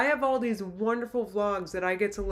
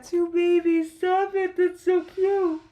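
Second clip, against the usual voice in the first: high, gushing reading of a cute comment; gushing high excitement; 10 semitones higher, 5 dB louder, slower than usual. Retold or imitated. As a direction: squeal it out as if the baby peacocks are right there. Reading a cute viewer comment aloud.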